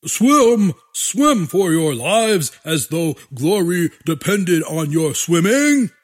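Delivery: shouting general; dictator speech. A narrator's reading. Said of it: "The generals screamed".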